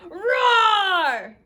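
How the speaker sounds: big, loud roar with rising pitch; playful full-voiced dinosaur roar. Reads aloud Dinosaur's roar.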